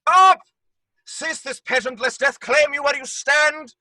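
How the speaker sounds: loud proclamation; commanding shout. Acted. Masked man halting the play, shouting. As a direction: stop the show by force.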